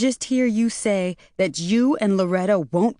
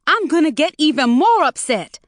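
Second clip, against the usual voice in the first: high, loud furious shriek; 8 semitones higher, 4 dB louder than usual. A narrator's reading. Mother shrieks down the phone at son-in-law. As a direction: scream at them, losing it. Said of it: "Maureen shrieked".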